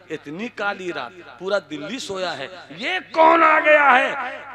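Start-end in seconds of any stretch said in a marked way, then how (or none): 2.8-4.5 alarmed cry. Acted. Husband alarmed at night.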